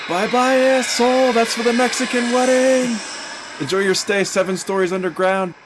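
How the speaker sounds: screaming, very high and loud; gloating yell. Acted. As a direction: yell it gleefully as you leave. Shouting from departing plane, villain.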